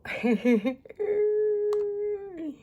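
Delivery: loud giggle into a long high squeal; giggle, then hold a high squeal that drops away; squealing laugh. Real, not acted. Teacher reacts to the mishap, squealing with laughter. Test goes wrong, she laughs.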